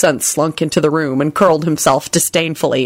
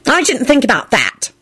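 pitched-up haughty admission; 6 semitones higher than usual. A narrator's reading. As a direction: admit it with a haughty air. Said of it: "Dorcas sniffed haughtily".

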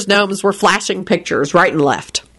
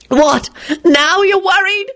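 high, slow, shrieking disbelief; 13 semitones higher, slower than usual. A narrator's reading. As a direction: pitch it up into an indignant shriek. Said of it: "she practically shrieked".

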